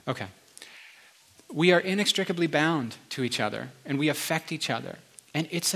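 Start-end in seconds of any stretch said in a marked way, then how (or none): none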